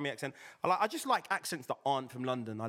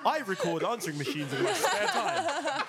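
hammed-up posh, well-spoken voice, higher in pitch; mock over-proper speaker showing off; well-spoken guy; 4 semitones higher, slower than usual. Co-host teasing a guest about his accent, mock well-spoken. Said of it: "you're fully just sitting there".

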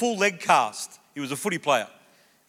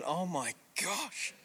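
freaking out thinking; 13 dB quieter, much slower than usual. Retold or imitated. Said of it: "And I'm freaking out thinking".

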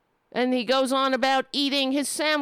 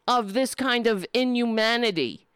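normal talking voice

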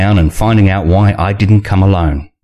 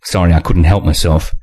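plain reading voice

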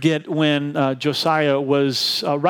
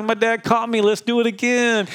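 put-on higher, gleeful toddler voice; happy toddler; 7 semitones higher than usual. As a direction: play the thrilled one-year-old.

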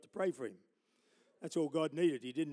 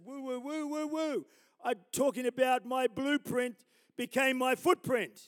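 calming whoa; 7 semitones higher, 6 dB louder than usual. Retold or imitated. Calming the angry judge.

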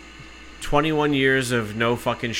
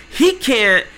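high, loud mimicked protest; retold outraged whine; indignant complaint; 10 semitones higher, 6 dB louder than usual. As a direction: imitate an agent tattling to the boss. Agent complaining to supervisor.

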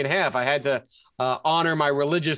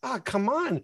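higher, frustrated come on; a mild fan's groan; exasperated groan; 8 semitones higher than usual. Retold or imitated. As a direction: let it out like a fan watching a miss. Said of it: "And I'm like".

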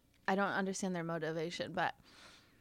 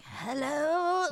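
lightly voiced old man's hello; a hint of a high, shaky old guy; 7 semitones higher, 6 dB louder than usual. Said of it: "I assumed you were an old guy".

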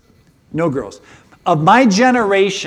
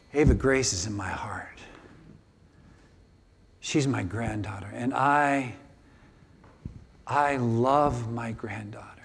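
hushed and tender, with long pauses; emotional; 4 semitones lower, 4 dB quieter than usual. Real, not acted. Grandfather recalling granddaughter's birth, hushed and emotional. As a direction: let it sink toward a near whisper, deeply moved. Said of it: "that is just so emotional".

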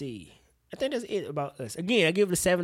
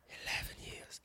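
hushed, voiceless whisper; awed whisper; 17 dB quieter, slower than usual. Real, not acted. Fan waiting on this for years, awed whisper. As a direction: whisper it as if it's sinking in. Repeats the long wait in awe.

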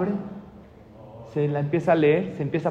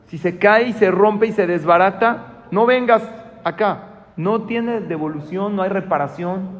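slow, loud, raised-pitch warning; stern warning; 3 semitones higher, 5 dB louder, slower than usual. Acted. Seller warning a buyer there are no returns, stern and emphatic. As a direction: lay down the rule forcefully, word by word. Seller lays down strict condition.

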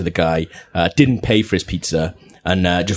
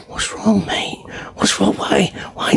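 high, breathy whisper; hugging the attacker, hushed mock whisper; quiet words; 10 semitones higher, slower than usual. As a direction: soothe him in a hushed voice. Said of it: "really quiet words".

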